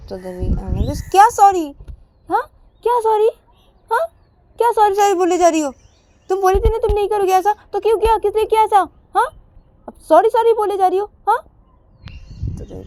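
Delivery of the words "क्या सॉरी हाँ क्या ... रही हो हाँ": high, loud, fast telling-off; angry scolding. Acted. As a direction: snap back at her excuses. Ananya scolds her sorry.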